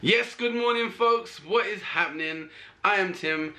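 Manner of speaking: high, bright hello to viewers; cheerful greeting. Real, not acted. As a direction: greet them with big energy. Vlog greeting.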